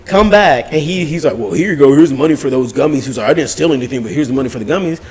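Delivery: ordinary talk, nothing marked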